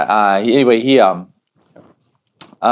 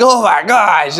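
high mock dismay; overwhelmed little kid; 8 semitones higher, 4 dB louder, slower than usual. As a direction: groan it like a kid given an impossible task.

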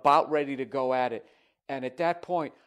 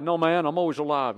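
lightly voiced showman, loud and raised; 5 semitones higher, 6 dB louder than usual. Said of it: "He's just playing along".

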